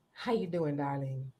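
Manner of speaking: lady-killer purr; low seductive greeting. Retold or imitated. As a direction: purr it low like a smooth operator.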